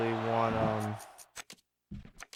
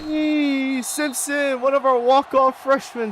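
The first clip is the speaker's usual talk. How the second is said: much higher, louder delighted call; loud happy shout of a name; excited shout; 12 semitones higher, 8 dB louder, slower than usual. Real, not acted. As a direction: hail the freshman loudly. Calls out a player with delight.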